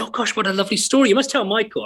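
a playful lift into an excited lady; imitating Palin's wife. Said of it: "And she said".

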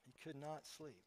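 quiet afterthought, repeating the words; soft echo. A narrator's reading. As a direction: let the line fall away to near silence. Echo after the emphatic line.

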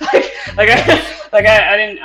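talking through a laugh. Real, not acted. Admitting to co-host they lacked context, laughing. Amused, laughing about knowing Auron.

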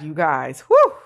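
a high, loud whew breaking into laughter; relieved laugh. Real, not acted. Host recalls a leap that paid off, relieved laugh. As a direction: let the relief burst out. Overwhelmed by how well it went.

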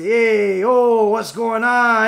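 loud, drawn-out Brooklyn Italian greeting; Italian-guy impression. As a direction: holler it like a caricature Brooklyn Italian. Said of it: "the Italian guys say".

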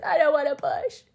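high mock whine; retold whiny protest. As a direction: object like a reluctant child.

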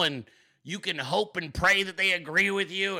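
ordinary delivery

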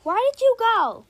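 high, loud call; calling after a pet. Real, not acted.

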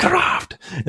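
put-on German-accented "Minecraft!" yelled high; mock German yell. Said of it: "scream in a highly German accent".

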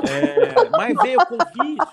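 risada alta e aguda; rindo. Real, not acted. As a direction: ri enquanto fala. Laughing at punchline. (rindo alto)

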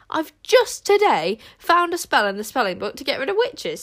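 proudly announcing the spell; triumphant. A narrator's reading. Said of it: "said Wish triumphantly".

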